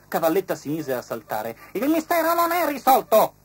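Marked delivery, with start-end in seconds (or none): 1.7-3.3 very high, loud grasshopper voice; grasshopper's voice. A narrator's reading. Grasshopper protesting, very high and loud. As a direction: hop up into a squeaky high voice.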